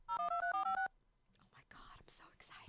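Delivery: very hushed, unvoiced thrilled whisper; excited whisper. Real, not acted. Waiting for a surprise call to connect, excited whisper. Whispers while the phone rings.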